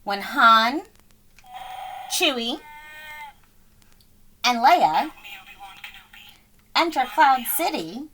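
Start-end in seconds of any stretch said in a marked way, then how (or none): none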